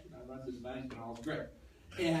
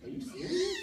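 very high-pitched incredulous question; mock disbelief. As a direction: squeak it out as exaggerated disbelief. Retold disbelief at having no checks.

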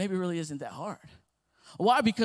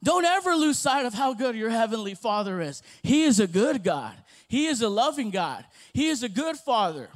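louder, emphatic preaching; 6 dB louder than usual. Acted. Pastor urging congregation about God's goodness, loud preaching. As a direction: proclaim it to the congregation.

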